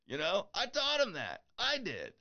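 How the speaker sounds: high, giggly proud gush; laughing delight. Real, not acted. Proud dad laughing about his son's line, high and giggly. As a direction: squeal with pride.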